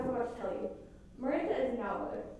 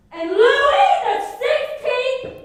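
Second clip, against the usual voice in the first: high, slow, loud fanfare-like announcement; grand proclamation; 13 semitones higher, 14 dB louder, much slower than usual. Acted. Student announcing the new king, in a loud, slow, high fanfare voice. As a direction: proclaim the king's name like a herald.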